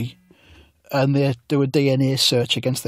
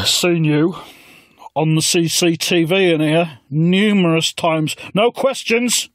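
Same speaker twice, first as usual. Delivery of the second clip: high, slow, put-on old man voice; mock Corbyn; 4 semitones higher, slower than usual. Corbyn warns the narrator in a high put-on voice. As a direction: ham up the Corbyn character.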